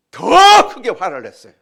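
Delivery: loud, high, stress on 더; shouted emphasis. Real, not acted. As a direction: shout 더 loudly. (더를 크게 외치며)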